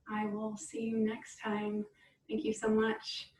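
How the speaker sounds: soft, calm cool-down voice; guided relaxation. Acted. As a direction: guide them gently into stillness.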